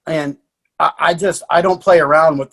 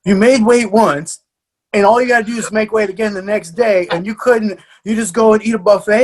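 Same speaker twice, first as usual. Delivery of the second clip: higher, louder mock telling-off; exasperated scolding; 5 semitones higher, 4 dB louder than usual. Coach berating fighter who must make weight again, exasperated. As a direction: tell her off in disbelief, pitched up. Retold scolding over buffet.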